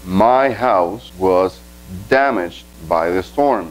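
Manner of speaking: very slow, every English word set apart; slow example reading. Shown as a demonstration. Teacher reading an example to Spanish learners, very slow and clear. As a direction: spell the sentence out slowly for the class. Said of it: "Vamos a tomar este ejemplo".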